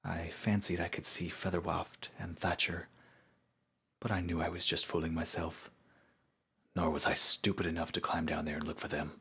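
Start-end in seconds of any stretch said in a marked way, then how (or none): none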